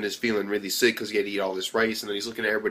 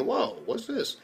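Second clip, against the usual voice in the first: higher, impressed gasp of a question; surprised coach; 10 semitones higher, 4 dB quieter, slower than usual. Retold or imitated. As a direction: let the coach be caught off guard. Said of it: "The coach is like".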